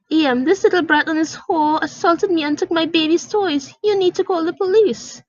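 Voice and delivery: high, shrill demand; shrill accusing mother. Acted. Mother demanding the employee call the police, shrill.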